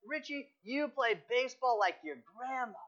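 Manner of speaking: mock the boy like angry teammates leaving; taunting teammates. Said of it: "they're calling back to him and they said".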